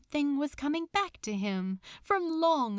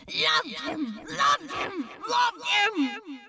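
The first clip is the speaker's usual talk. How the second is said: anguished shouting; 10 semitones higher, slower than usual. A narrator's reading. Echoing Crowleys shouting his truth back at him. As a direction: hurl it out in pain, higher and higher.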